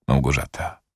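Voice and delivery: ordinary delivery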